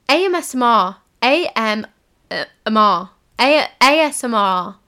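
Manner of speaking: loud, struggling to say A S M R; shouting A M S M R while trying for A S M R; shouting the letters. Real, not acted. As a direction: blurt out A S M R, loud and mangled. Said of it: "Why am I saying it like that?".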